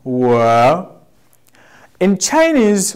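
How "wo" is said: "Wo" held long, dipping then rising; model pronunciation. Shown as a demonstration. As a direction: say "Wo" slowly for the class to copy. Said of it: "Everybody say wo".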